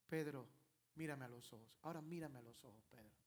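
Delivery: soft, low and gentle; the Lord asking Peter to look at him, tender; tender plea. Acted. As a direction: ask him softly to meet your eyes.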